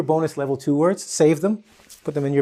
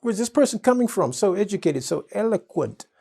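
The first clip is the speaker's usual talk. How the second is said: impressed; 7 semitones higher than usual. Pretend. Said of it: "They're gonna".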